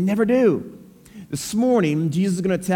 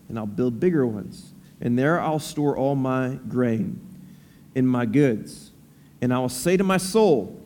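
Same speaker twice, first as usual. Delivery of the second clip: scripture reading; 3 semitones lower than usual. Acted. Reads scripture aloud.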